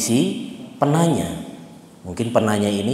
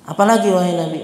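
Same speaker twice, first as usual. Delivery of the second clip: higher, louder retold question; mildly insistent, pitched-up plea; still unsatisfied; 8 semitones higher, 5 dB louder, faster than usual. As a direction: lightly act out someone pressing for another answer. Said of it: "Dia masih kurang puas".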